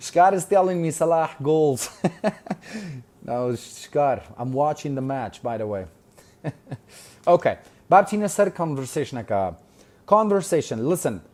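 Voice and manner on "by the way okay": usual speaking voice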